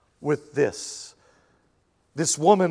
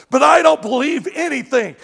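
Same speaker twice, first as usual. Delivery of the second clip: mock unbeliever; 7 semitones higher, 6 dB louder than usual. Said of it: "I always laugh when someone goes".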